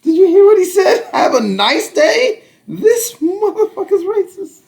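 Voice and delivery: high, slow, hammed-up shocked voice through laughter; squealing mock horror, laughing; mock outraged gasp. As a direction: play a scandalized bystander clutching pearls. Mocking an offended person's overreaction.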